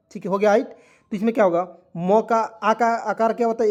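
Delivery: ordinary delivery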